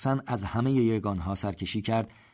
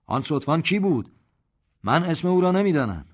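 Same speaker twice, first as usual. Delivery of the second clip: raised, higher voice of a general asking the hall; commanding general's call; 8 semitones higher, 7 dB louder than usual. A narrator's reading. General questions the assembled officers, loud and commanding. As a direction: call out to the whole room like a general.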